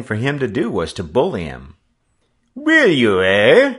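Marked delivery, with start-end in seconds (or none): 2.5-3.7 put-on high, loud French-accented skipper bursting out; shrill, wrathful. A narrator's reading. French Pete bullying the defiant boy, shrill and wrathful. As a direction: voice rising sharply into a shrill, angry challenge. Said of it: "His shrill voice rose wrathfully".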